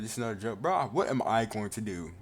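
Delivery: usual speaking voice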